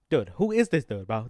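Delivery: sudden loud outrage; exasperated outburst. Real, not acted. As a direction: blurt it out, fed up and confused. Angry at the press conference host.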